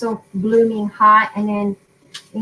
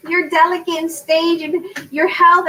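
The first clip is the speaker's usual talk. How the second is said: imitating fretful daughter; 6 semitones higher than usual. Mother retells daughter's warning about her health, mock fretful. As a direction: mimic an over-worried daughter. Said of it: "My daughter called me freaking out".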